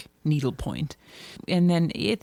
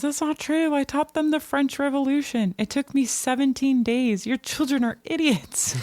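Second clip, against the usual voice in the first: much higher, indignant mock yell at ordinary volume; retold yelling back; 9 semitones higher than usual. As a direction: imitate an outraged governess protesting in a high voice. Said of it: "Nani yells back".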